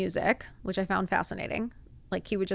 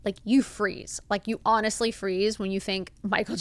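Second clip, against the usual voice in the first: normal talking voice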